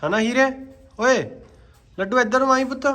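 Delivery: high loud doting call; calling out to horse. Real, not acted. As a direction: call the pet over sweetly.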